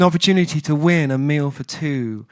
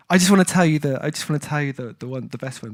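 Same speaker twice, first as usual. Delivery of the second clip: ordinary talk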